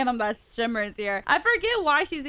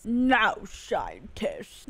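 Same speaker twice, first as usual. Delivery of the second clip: slow, lower imitation, drawn right out; slow, smooth mimicry; smooth villain voice; 5 semitones lower, much slower than usual. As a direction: imitate a smooth voice, stretching each word. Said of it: "Nice and smooth".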